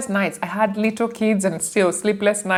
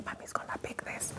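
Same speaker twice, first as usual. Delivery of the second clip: put-on hushed child whisper; 20 dB quieter, faster than usual. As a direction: whisper it so mom can take the call. Kid whispering while mom takes call.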